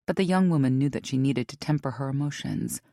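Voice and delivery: plain reading voice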